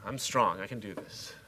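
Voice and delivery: soft, low self-reassurance; self pep talk. Acted. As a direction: quietly talk yourself through it. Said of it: "I tell myself that".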